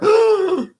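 sharp high gasping cough that catches mid-breath; a real cough that jumps out high and loud; sudden cough. Real, not acted. Host startled into coughing on camera. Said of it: "See, I coughed at that".

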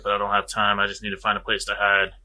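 normal talking voice

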